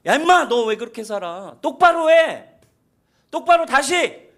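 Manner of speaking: God scolding Abraham in a furious mock shout; angry rebuke. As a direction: yell at him to straighten up. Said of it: "책망이나".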